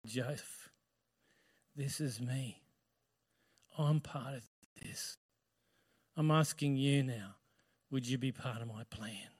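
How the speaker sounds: low, very soft, measured appeal; hushed voice of God. Acted. God asking a man in a dream, hushed and low. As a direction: speak softly and low into a sleeper's dream.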